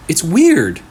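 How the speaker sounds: Gia uneasy. Acted. Gia tells her friend Leo the glitch is weird, uneasy. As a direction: let her unease slip out.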